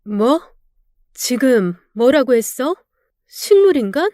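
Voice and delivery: incredulous high rising 식물인간?; shocked disbelief. Acted. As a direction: repeat 식물인간 in stunned disbelief, pitch leaping. Wife repeats in shock.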